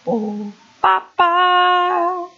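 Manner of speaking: sung ba-ba leaping high; high, drawn-out final ba; vocal sound effect. Shown as a demonstration. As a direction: mimic a game noise, high and held. Imitates a game saw's movement.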